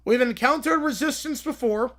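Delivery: ordinary talk, nothing marked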